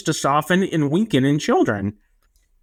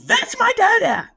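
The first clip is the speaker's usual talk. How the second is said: toddler voice imitation. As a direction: imitate a toddler announcing his dad. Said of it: "He'll just let a stranger know".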